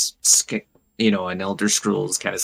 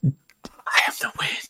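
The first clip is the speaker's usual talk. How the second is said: slow airy whisper as the wind; gusting windy whisper; 5 dB quieter, slower than usual. Pretend. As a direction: breathe it out like wind. Echoing the game's wind role.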